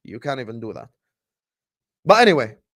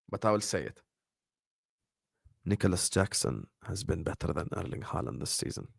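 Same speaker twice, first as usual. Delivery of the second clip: hushed, much softer and lower, almost breathless; whispered secret; 8 semitones lower, 11 dB quieter than usual. Shown as a demonstration. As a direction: let it slip out like a confession. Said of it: "I will whisper it".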